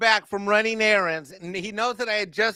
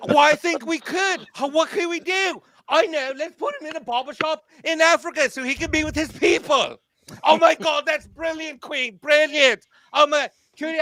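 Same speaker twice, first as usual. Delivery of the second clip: higher, gushing mock writer voice with squealed woos; mocking Disney writers; 5 semitones higher than usual. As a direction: ham up giddy writers cheering their own idea. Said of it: "I can just imagine them".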